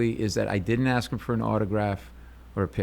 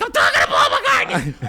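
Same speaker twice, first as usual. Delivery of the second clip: inner freak-out; 6 semitones higher, 7 dB louder than usual. Retold or imitated. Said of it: "On the inside, I'm like".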